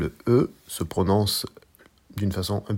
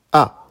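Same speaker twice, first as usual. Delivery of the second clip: A short, loud, falling from high; fourth tone on A; 7 semitones higher, 10 dB louder than usual. Shown as a demonstration. Teacher showing learners Mandarin A, falling tone. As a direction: drop A sharply from the top. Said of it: "Une dernière fois".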